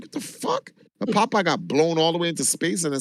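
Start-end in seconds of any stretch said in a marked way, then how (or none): 0.0-0.7 pissed. Retold or imitated. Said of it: "Saitama was, like, pissed".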